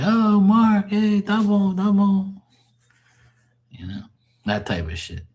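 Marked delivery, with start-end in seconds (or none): 0.0-2.4 high cheerful uncle voice; uncle's happy greeting, much higher; imitating uncle. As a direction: mimic the uncle greeting warmly. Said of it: "he'd be like".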